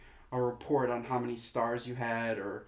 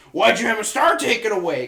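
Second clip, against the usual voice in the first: scolding mom; 6 semitones higher, 9 dB louder than usual. Retold or imitated. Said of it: "my mom was like".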